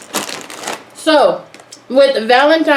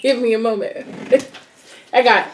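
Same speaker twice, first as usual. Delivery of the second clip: normal talking voice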